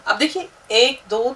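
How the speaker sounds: normal talking voice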